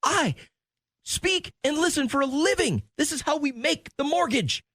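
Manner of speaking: high-pitched imitation of an indignant wife; hammed-up exasperated comeback; indignant retort. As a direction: insist on your credentials, voice way up. Wife's indignant retort in retold quarrel.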